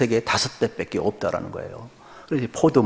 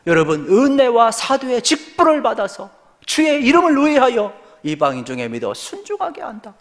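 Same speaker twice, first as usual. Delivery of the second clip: scripture proclaimed high and loud; full-force preaching; 12 semitones higher, 5 dB louder than usual. Real, not acted. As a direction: press the verse on the congregation with raised voice.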